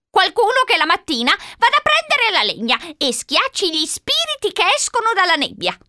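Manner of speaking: put-on high-pitched, insistent old-lady voice; old woman pleading. A narrator's reading. Old woman begging Charlie for company, pleading.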